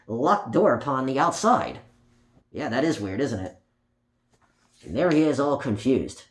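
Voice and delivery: plain reading voice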